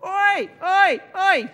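very loud high oi oi oi; squirrel shouting oi. Acted. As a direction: bang out three rude calls.

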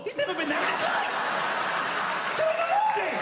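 rising to high, frantic outburst; exasperated father. Acted. Father to son at night, exasperated outburst. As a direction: climb to a desperate high yell.

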